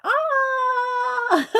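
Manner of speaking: high cartoon yelp while tumbling down; a quick playful "ah" as he drops through the floor; mock falling scream. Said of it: "busted through the floor and went".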